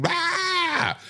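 high mock wailing cry; imitated wail. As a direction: mimic a child's shrill wail. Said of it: "he's screaming and wailing".

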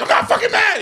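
high-pitched mock tantrum; angry denial. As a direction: protest hotly in a high voice. Acting out friend denying he is mad.

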